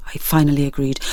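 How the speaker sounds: usual speaking voice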